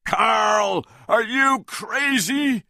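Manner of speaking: high, loud outraged yell; shouted. A narrator's reading. Jack confronting Carl, a high outraged shout. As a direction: yell it in disbelief. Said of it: "Jack shouted".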